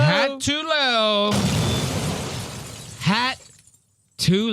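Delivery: high, slow mocking singsong; mock high drawn-out voice. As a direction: stretch it out high and teasing. Mocking the hat on screen.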